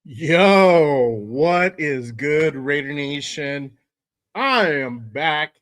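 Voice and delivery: loud, drawn-out hello to the fans; host's big drawled-out show opener; hype show greeting. Real, not acted. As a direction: hail the audience big, stretching the words. Show host hails his audience.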